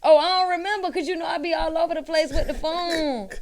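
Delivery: high, loud mocking imitation; mocking excuse. Barber mimicking a client asked about paying, mockingly. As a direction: make the excuse sound ridiculous.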